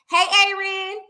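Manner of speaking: normal talking voice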